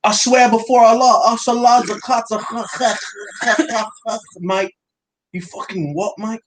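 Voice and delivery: hammed-up London roadman squaring up, mate; London roadman accent. Friend mocking a UFC fan in a London roadman accent.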